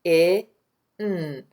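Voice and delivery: "I, N" as separate short-i and n sounds; sounding out. Shown as a demonstration. Teacher tapping sounds for spelling, sounding out. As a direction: sound out "I, N" one sound at a time, pausing between. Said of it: "Let's tap those sounds".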